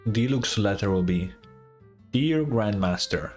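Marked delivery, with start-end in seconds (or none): none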